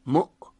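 مؤ- cut off abruptly on the hamza; glottal stop. Shown as a demonstration. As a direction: stop the sound dead at the end of مؤ-. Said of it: "باید صداش رو قطع کنید". (مؤ- با قطع کامل صدا روی همزه)

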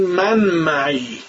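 من معی drawn out very slowly with the Persian a; Persian pronunciation, slow. Shown as a demonstration. Teacher demonstrating the Persian way of saying من معی. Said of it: "ولی ما میگیم".

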